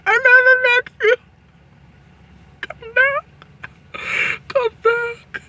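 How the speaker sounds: hammed-up dying call, fading out; fading dying plea. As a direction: beg him back as life fades. Dying wife calls husband back.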